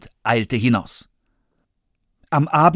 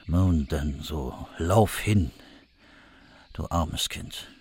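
much lower, slow, fading to a whisper; pitying hunter; 7 semitones lower, 6 dB quieter, slower than usual. Acted. Hunter sparing the poor child, low and hushed. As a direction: let her go gently, almost breathing it.

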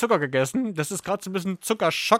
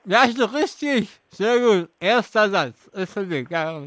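mouth full; 3 semitones higher than usual. Real, not acted. Host still chewing marshmallows.

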